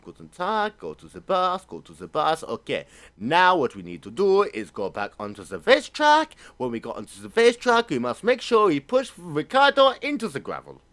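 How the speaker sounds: warm up into a hammed-up German accent; mock German accent. Team ordering a push on Ricciardo, mock German accent. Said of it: "your German accent".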